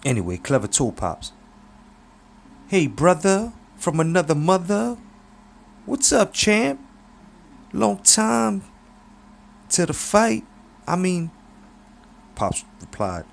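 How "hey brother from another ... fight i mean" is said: much higher, slick hustler voice; Clever's voice. Acted. Clever hails his old friend Pops in a high, slick voice. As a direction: greet him like a smooth, flashy hustler.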